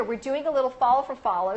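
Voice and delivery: ordinary talk, nothing marked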